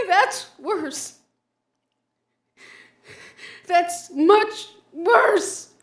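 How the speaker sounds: high, loud, half laughing, half crying; laughing through tears. Acted. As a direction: crack up and break down at once. Vision of dead girl, laughing-crying.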